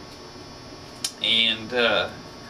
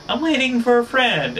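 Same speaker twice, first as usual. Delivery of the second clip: mock woman's reply; 12 semitones higher, 4 dB louder than usual. Woman replies to the yeller.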